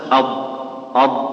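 ض drawn out slowly from the side of the tongue in أض، أض،; slow dad demo. Shown as a demonstration. Teacher slowly demonstrating أض، أض، to students. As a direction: say أض، أض، slowly with a careful ض. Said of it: "فيها صعوبة، بينما اليسار سهل".

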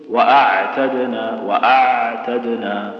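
"وأعتدنا" drawn out slowly with a balanced ع; correct moderate ain. Shown as a demonstration. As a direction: recite "وأعتدنا" slowly, the ع half flowing. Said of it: "تقول".

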